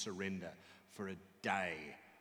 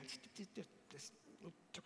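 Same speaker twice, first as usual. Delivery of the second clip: hushed, urgent stammer; nervous father's retold whisper, tripping over the start; 7 semitones higher, 14 dB quieter than usual. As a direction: shush him under your breath. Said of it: "why are you whispering?".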